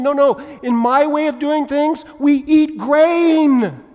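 loud, high, impassioned sermon delivery; full-force preaching. Acted. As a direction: preach it out loud to the whole room, stretching the big phrases.

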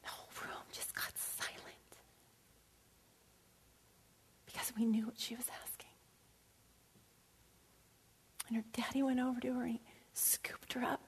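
soft, near-whispered telling; whispered story moment; hushed. Acted. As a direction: drop to a hush for the silent room.

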